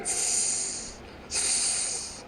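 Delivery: unvoiced hissing through the teeth; snake hiss. A narrator's reading. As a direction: hiss like an angry cobra. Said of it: "hisses back again".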